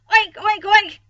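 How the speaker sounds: high, shouted pig noises; playful loud oinking. A narrator's reading. Boy tossing straw as a pig, oinking loudly. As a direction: oink loudly like an excited child.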